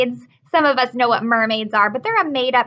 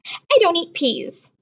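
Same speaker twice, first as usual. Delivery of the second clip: put-on higher, slow, picky little girl voice; fussy little sister; 6 semitones higher, slower than usual. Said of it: "said Lola".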